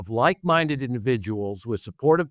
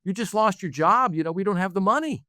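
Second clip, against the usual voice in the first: higher-pitched imitation of his child; worried kid's objection; 7 semitones higher than usual. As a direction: pipe up like a kid protesting to dad. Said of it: "And a kid said".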